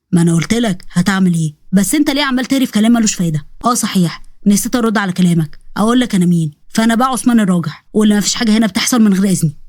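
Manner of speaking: low, unruffled tough man; cool warning from a protective man; Osman, tough protector. Acted.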